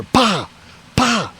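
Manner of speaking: two high, popped "pa" bangs; imitated gunshots. Hunter hears brother-in-law shooting the hog, imitated gunshots. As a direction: mimic rifle shots, one then another. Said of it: "I hear".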